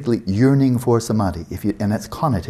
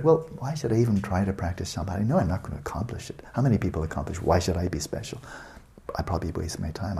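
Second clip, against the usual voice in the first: softer, sinking self-talk of a reluctant student; mopey self-doubt; 3 semitones lower, 7 dB quieter than usual. Retold or imitated. A lazy student talking himself out of practice, softly mopey. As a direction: talk yourself out of trying, trailing softer.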